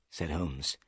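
usual speaking voice

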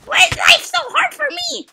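hammed-up high screaming tantrum; very loud, high-pitched pretend meltdown; mock rage. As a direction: scream it like a raging gamer, mocking him. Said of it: "This guy's getting so ragey. He's like".

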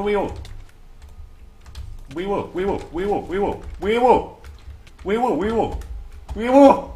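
high, loud wee woo siren, rising to a blare; building siren wail; siren imitation. Siren noise during battle.

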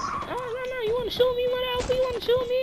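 very high, soft squeaky voice; mock-scared squeak at a teammate; squeaky falsetto.

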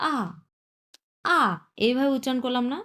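'আ' said quickly with a falling tone; fourth tone. Shown as a demonstration. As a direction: say 'আ' as a brisk fourth tone, dropping sharply. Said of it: "চার number tone আমি উচ্চারণ কিভাবে করলাম". ('আ' চতুর্থ টোনে দ্রুত উচ্চারণ)

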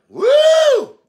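high, loud whoop; preacher's whoop. Real, not acted. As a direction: let out a loud, high shout of joy. Preacher whoops mid-sermon.

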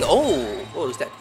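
usual speaking voice

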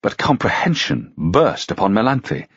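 usual speaking voice

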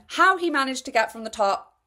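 usual speaking voice

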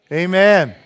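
a big unison amen called out; loud amen. Real, not acted. Congregation called to respond, a loud amen. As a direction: answer loud and full, all together.

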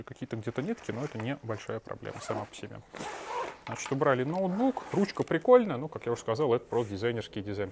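ordinary talk, nothing marked

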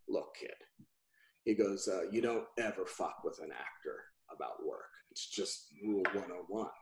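low, hushed imitation of a serious client; quiet warning. Client warning his agent not to joke about work, retold low and quiet. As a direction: imitate a soft, grave warning after a silence. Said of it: "quiet for a moment".

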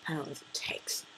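breathy whispered question; whispering. Shown as a demonstration. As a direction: whisper it under your breath.

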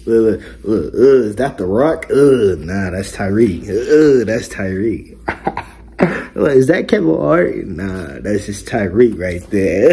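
loud, hyped up; excited. Real, not acted. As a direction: brag about yourself loudly. Excited comparisons to celebrities.

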